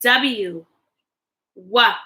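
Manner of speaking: ordinary delivery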